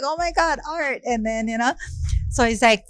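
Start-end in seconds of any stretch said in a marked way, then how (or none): 0.0-1.0 awed, high-pitched retold greeting; blown away. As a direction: re-enact meeting an idol, pitched high. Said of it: "blown away".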